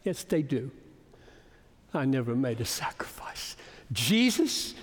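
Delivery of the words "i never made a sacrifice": whispered quote. Real, not acted. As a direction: whisper it softly, in awe. Repeats missionary quote softly.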